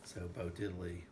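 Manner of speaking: ordinary talk, nothing marked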